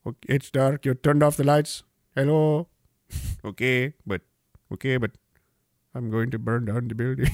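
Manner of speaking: low mock mutter.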